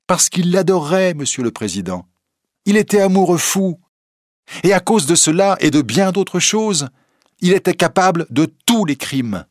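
put-on emphatic, assured young witness; earnest young reporter. A narrator's reading. Reporter explains Larsan's motive to the president, emphatic. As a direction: explain it with conviction.

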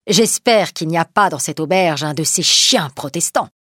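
plain reading voice

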